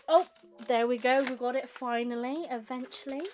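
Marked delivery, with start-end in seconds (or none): none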